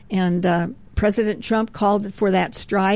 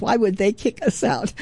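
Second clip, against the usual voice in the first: ordinary delivery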